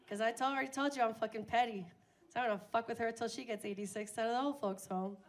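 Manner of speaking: ordinary talk, nothing marked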